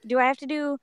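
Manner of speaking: lightly demonstrating a cheerleader's high voice; high-pitched cheerleader. Retold or imitated. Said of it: "normal cheerleader high-pitched voice".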